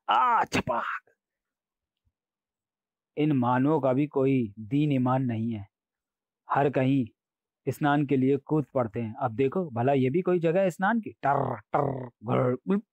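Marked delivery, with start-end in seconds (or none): 0.1-1.0 high, drawn-out "aa" bursting into a splash; voiced splash, pitched high; splash sound effect. A narrator's reading. Narrator imitates a loud water splash. 11.1-12.8 rolled croaks and gurgles; comic animal-noise imitation; imitated croaking. A narrator's reading.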